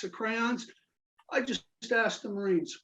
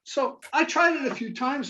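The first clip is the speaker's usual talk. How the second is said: ordinary delivery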